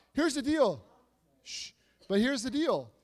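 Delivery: ordinary talk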